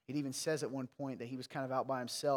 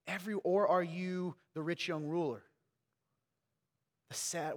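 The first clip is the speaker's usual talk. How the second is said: usual speaking voice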